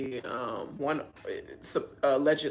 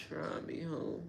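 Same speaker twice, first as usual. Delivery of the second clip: breathy whispered dare, much softer; whispered threat; 11 dB quieter than usual. Pretend. Vlogger repeats imagined comeback to Taylor, whispered threat. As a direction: hiss the dare under your breath. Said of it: "That's what I would have been like".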